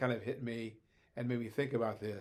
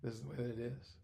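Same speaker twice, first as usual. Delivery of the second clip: cold flat decree; 7 dB quieter than usual. Retold or imitated. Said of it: "just cold and say".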